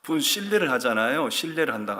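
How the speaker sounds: normal talking voice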